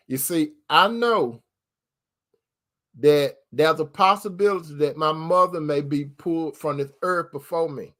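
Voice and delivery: ordinary delivery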